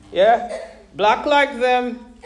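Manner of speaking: ordinary talk, nothing marked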